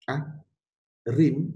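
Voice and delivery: ordinary delivery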